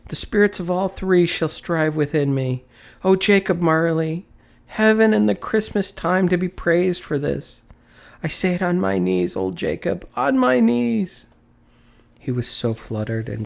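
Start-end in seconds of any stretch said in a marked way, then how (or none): 0.1-11.2 high, loud, trembling thanks; fervent prayer. A narrator's reading. Scrooge praising heaven on his knees, fervent and high.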